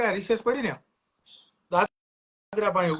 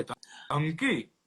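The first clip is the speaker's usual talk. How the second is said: stretched slow mocking question with a laugh; mock surprised; 2 semitones lower, 4 dB quieter, much slower than usual. Girl mocking the boy's exam threat, slow and amused. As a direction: drag it out, amused and daring. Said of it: "મને કે".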